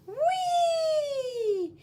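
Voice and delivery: very high, loud whee; gleeful whee. Acted. Kids sliding down, high excited whee. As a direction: squeal it like a slide.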